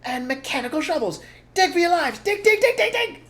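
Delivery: very high, loud urgent shouting; frantic rising shout to dig. Acted. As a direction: yell at the family to dig. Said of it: "shouted Mister Fox".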